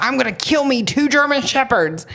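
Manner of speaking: frustrated threat. Retold or imitated. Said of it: "I was like".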